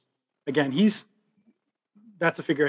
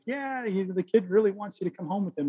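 light character voice; 6 semitones higher than usual. Retold or imitated. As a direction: voice the sheepish messenger lightly. Retold movie dialogue, put-on voice.

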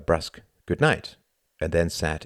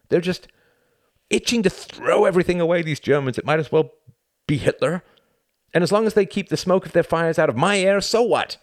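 agitated rant building higher and louder; mounting agitation; 11 semitones higher, 5 dB louder than usual. A narrator's reading. Tom argues with himself late at night, mounting agitation. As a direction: work yourself up into an exasperated tirade. Tom's mounting inner argument.

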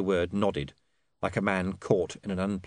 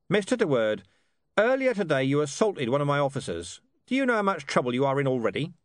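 higher-pitched commander, quickening into a warning; stern police commander; 6 semitones higher, 3 dB louder than usual. Acted. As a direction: warn a troublesome witness sternly.

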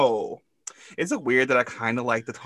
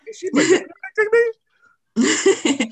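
mock dramatic; 7 dB louder, faster than usual. Mock worried aside.

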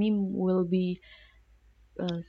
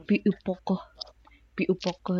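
mock British accent; much faster than usual. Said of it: "British people be like".

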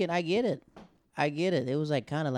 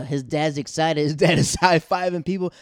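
laughing; 5 semitones higher, 9 dB louder than usual. Real, not acted. Excited retelling, laughing.

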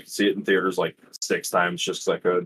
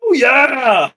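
much higher, louder meme yell; Kool-Aid Man shout; 7 dB louder than usual. Acted. Host answers his friend with the meme, yelling it high and loud. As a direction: bellow it like bursting through a wall.

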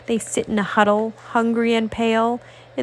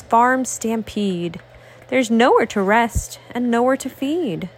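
ordinary delivery, nothing marked

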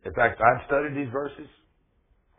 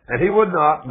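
louder, higher, hammering the line; preacher hammering the point, loud; emphatic repeat; 5 semitones higher, 9 dB louder than usual. Real, not acted. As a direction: drive it home to the congregation.

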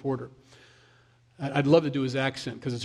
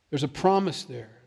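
usual speaking voice

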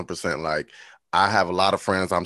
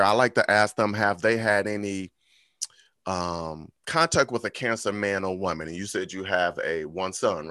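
normal talking voice

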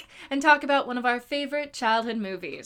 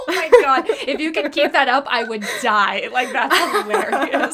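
laughing; 7 dB louder than usual. Real, not acted. Reacting to accent joke.

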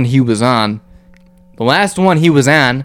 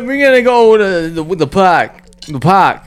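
put-on Boston accent, pitched higher, pushing hard on park; hammed-up Boston guy heading to the ballpark; mock Boston accent; 6 semitones higher than usual. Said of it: "it's a Bostonian accent".

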